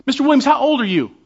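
put-on high, curious teenage voice; a high-voiced teen asking the teacher's age; student asking. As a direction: pitch it up like a blunt teenager. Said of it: "he's like".

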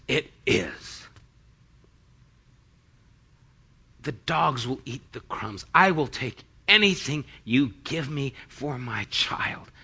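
soft, earnest, halting plea; humble pleading. Retold or imitated. Mother accepting crumbs for her child, humble pleading. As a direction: plead quietly and sincerely.

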